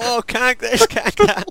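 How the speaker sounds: high, loud whiny imitation; mocking whiny friend. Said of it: "But you're in the background going".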